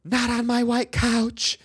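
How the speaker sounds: high, loud, drawn-out mock shriek; mom's scream. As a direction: scream it like an outraged mother, stretched out. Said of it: "reaction as a loving mother was to scream".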